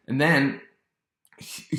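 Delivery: normal talking voice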